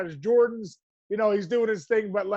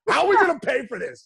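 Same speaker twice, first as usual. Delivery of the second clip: louder, higher panicked question; worried outburst; 5 semitones higher, 5 dB louder than usual. Retold or imitated. Son retelling his worry over college costs, in a louder panicked voice. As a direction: blurt out the worry about money. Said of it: "I was like".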